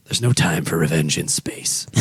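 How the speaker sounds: deep, slow trailer announcer; movie trailer voice. Retold or imitated. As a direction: intone it like a movie tagline. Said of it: "slogan for the next Star Trek movie".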